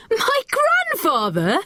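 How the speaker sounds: high, slow incredulous question; can't believe what he hears; boy incredulous about his grandfather; disbelieving. A narrator's reading. Boy disbelieving, starts laughing.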